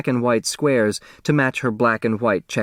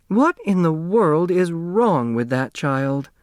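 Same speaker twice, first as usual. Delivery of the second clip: exasperated mother; 8 semitones higher, slower than usual. A narrator's reading.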